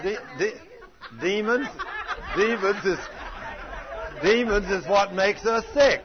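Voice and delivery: loud, high preaching shout; building fiery sermon shout; preaching at full force. Acted. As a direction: drive the point home like a revival preacher. Preaching climax, rising force.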